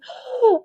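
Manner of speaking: a high, loud choked gasp. Retold or imitated. Trying to tell her mom, high choked gasp. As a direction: gasp as if words won't come out. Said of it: "I'm trying to get it out".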